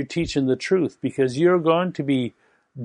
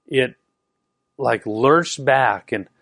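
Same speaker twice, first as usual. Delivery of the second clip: normal talking voice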